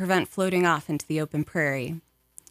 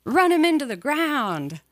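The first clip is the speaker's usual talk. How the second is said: higher, louder thrilled yell; rising enthusiasm; 9 semitones higher, 4 dB louder than usual. A narrator's reading. Passenger cheering father on in the race, excited. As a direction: caught up in the race, cheer him on. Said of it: "in rising enthusiasm".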